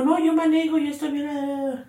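insistencia terca en tono agudo; necia. Retold or imitated. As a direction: negarse con terquedad, la voz subida. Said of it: "yo me pongo de necia". (terca, asegurando que está bien)

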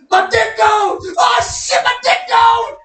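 mock panicked yell. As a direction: yell it like he is panicking, over the top. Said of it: "I'm like".

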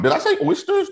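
high startled did I say oysters; disbelieving question. Real, not acted. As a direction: pitch jumps way up on oysters. Asking herself in disbelief.

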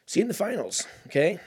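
taunting rivals, voice jumps high; cocky finals taunt squeaking high; voice cracking high. Real, not acted. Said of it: "What happened to my voice?".

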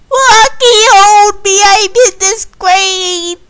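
high, loud, drawn-out lament; melodramatic wail. Acted. Candy to Rocky in her shame, melodramatic wail. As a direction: wail for a hug, hammed-up.

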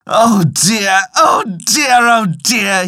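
high-pitched wail in a storyteller's voice. A narrator's reading. Old farmer grieves his killed wife, wailing aloud. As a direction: lament it high, rising on the repeat. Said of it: "he wailed aloud".

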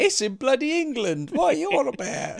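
high, loud indignant put-on voice; hammed-up incredulous grumble; mock disbelief. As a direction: scoff like a scandalised fan.

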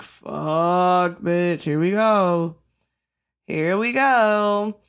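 slow, heavy, dragged-out groan of resignation; resigned dread. Retold or imitated. Moviegoer bracing for a sad true story, slow resigned dread. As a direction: drag it out like you already know it's going to hurt. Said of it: "you're like".

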